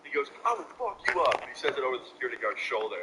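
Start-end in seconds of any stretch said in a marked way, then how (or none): 0.4-1.4 high-pitched imitated threat; mock angry threat. Rival threatening over the guard's shoulder, high mock anger. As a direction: mimic a riled-up little rival, pitched way up.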